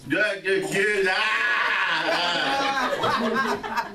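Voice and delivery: hammed-up drunk babble; mock drunken wail, rising in pitch. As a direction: imitate a drunk losing the words into noise. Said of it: "That's drunk".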